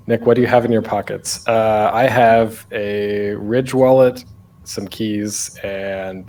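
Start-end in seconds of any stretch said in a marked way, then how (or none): none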